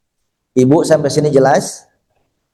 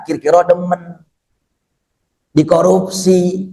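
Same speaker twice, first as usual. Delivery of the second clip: ordinary talk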